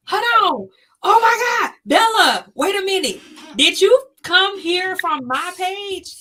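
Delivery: loud, high excited greeting; excited shouting. Real, not acted.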